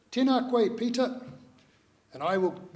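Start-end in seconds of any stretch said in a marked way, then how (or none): 0.1-1.2 loud, higher-pitched greeting call. Retold or imitated. Elderly Rangi calls out to a boy passing the shop, calling out. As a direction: hail someone from across the way. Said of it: "Rangi would call out to me".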